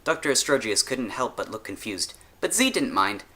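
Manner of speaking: usual speaking voice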